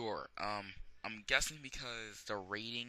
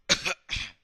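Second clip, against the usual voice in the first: dry cough from a sore throat; hoarse throat-clearing cough; 6 semitones higher, 13 dB louder than usual. Real, not acted. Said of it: "Sore throat".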